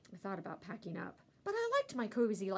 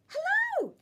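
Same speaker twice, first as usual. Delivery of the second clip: high, loud dog yap of a hello; a yappy dog greeting; barking hello; 10 dB louder than usual. A narrator's reading. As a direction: bark it out like an excited dog. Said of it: "she barked".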